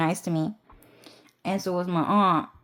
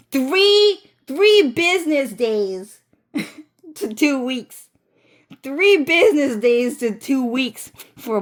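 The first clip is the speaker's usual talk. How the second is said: incredulous rant; 4 semitones higher than usual. Real, not acted. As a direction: exclaim the timeline in disbelief. Ranting about the timeline.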